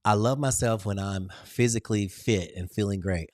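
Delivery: normal talking voice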